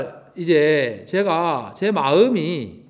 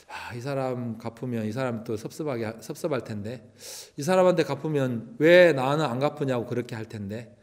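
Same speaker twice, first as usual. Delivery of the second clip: much softer, lower private fretting; worried murmur; 4 semitones lower, 6 dB quieter than usual. Retold or imitated. Mother alone at night fretting over debts, soft and low.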